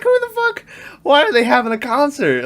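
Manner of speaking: very high, incredulous shriek; baffled outrage. Retold or imitated. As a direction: shoot way up in disbelief. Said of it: "And I was like".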